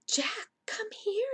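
high, slow singing-out call. A narrator's reading. Annie calling Jack over, calling out high. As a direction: stretch it out high to summon someone. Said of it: "Annie called".

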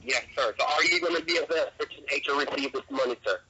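usual speaking voice

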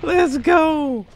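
high victory cheer; excited cheer. Real, not acted. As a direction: celebrate the win loudly. Celebrating the win.

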